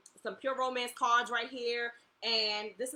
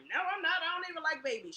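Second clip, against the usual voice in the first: higher, louder indignant no; friend's denial; 8 semitones higher, 4 dB louder than usual. Retold or imitated. Girl from school rejecting the prediction, higher and indignant. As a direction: scoff at the idea.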